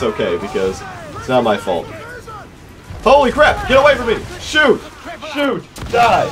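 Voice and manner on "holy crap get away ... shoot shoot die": high, loud frantic battle cries; panicked yelling. Real, not acted. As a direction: yell in panic as enemies close in.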